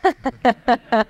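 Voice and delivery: a warm burst of laughter at the joke; hearty laugh. Real, not acted. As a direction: laugh out loud, amused. Host laughs at tape joke.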